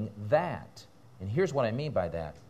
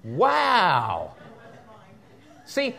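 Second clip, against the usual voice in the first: usual speaking voice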